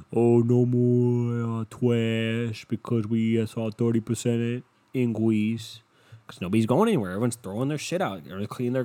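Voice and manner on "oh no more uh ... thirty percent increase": slow, drawn-out put-on voice; mock company voice. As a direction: stretch it out like a mock official notice. Mocks provider's email in put-on voice.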